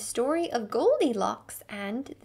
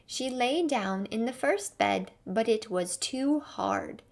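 ordinary delivery, nothing marked

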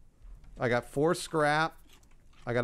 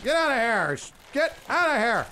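yelling; 9 semitones higher, 4 dB louder than usual. Real, not acted. Yelling at attacking shark.

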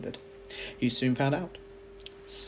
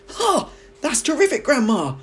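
much higher, louder delighted cry; excited boy's cry; 14 semitones higher, 9 dB louder than usual. A narrator's reading. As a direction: George crying out in thrilled amazement. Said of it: "George cried".

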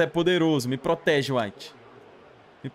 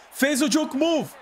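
excited shout; 7 semitones higher, slower than usual. Real, not acted. Excited about the run.

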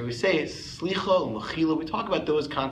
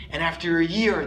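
normal talking voice

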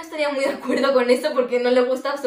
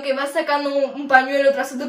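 normal talking voice

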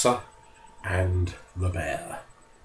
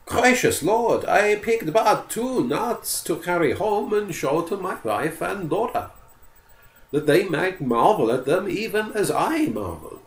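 higher, humble and polite; pleading forester; 10 semitones higher than usual. Acted. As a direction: offer to pay, anxious to please.

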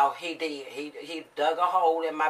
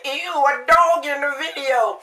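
high, loud imitation of a startled viewer; mock disgust; 8 semitones higher, 5 dB louder than usual. Imitating viewers who spot the dog, mock-appalled. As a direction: exclaim it in put-on disgust. Acting out the imagined viewers' reaction.

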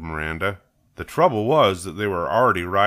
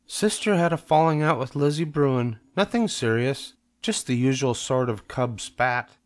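higher-pitched storybook mother; reassuring Mama; 6 semitones higher than usual. Acted. Mama explains a cub spat to Papa, reassuring. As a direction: brush it off in a lifted voice.

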